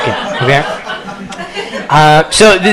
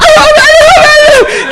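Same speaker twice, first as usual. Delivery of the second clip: high, loud yelps of pain; pained screaming; 6 dB louder, slower than usual. Retold or imitated. Kid pinned under teacher's chair, yelping in pain. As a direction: yelp like your finger is crushed. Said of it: "that's what I was screaming".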